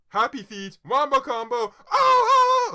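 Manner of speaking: very high, loud squealing cry; excited shriek. Real, not acted. Reading the box office list, shrieking excitedly. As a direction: shriek with glee.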